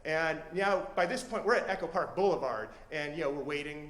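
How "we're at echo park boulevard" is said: ordinary delivery, nothing marked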